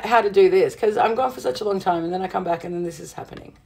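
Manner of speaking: ordinary talk, nothing marked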